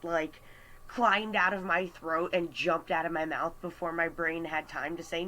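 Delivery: ordinary talk, nothing marked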